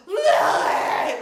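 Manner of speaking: loud, cracking panicked yell; panicked shout. Retold or imitated. Wife reenacting husband's panicked shout for their daughter. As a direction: call out in real alarm. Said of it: "you could just see and hear, like, the panic in his voice".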